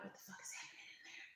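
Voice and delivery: breathless whispered peek around the corner; curious little page whispering. Pretend. Reader voicing the peeking page card, a whisper. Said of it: "you see this little cute little page peeking".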